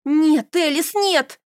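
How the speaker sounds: loud high angry no; flaring up. A narrator's reading. Carol flaring up at Elis, loud and high. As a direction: snap back in a flash of anger. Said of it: "вспылила я".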